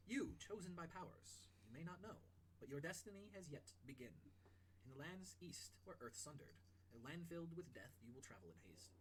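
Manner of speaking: slow, solemn scroll reading; measured game master's oracle; prophecy reading. Acted. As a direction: intone an ancient prophecy with long, weighty pauses. Reads out a mysterious scroll.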